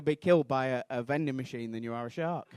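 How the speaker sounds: ordinary delivery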